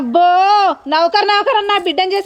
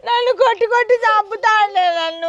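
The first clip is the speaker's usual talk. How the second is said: daughter weeping to her mother, words breaking; sobbing; 2 semitones higher than usual. Acted. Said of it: "ఏంది బిడ్డ ఏడ్చుకుంటూ వస్తుంది?".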